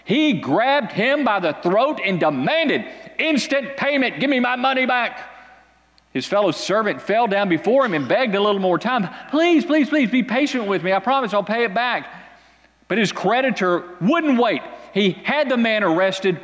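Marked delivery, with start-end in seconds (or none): 4.2-5.3 demanding creditor. Acted. 9.3-12.0 high, pleading servant, please after please; begging servant. Acted. Fellow servant begging the creditor for time, high and pleading.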